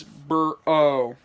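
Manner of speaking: "Bur-O" said slowly as bur, oh; slow, separated "Bur-O"; split pronunciation. Shown as a demonstration. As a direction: sound out "Bur-O" in two parts. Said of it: "how to say them".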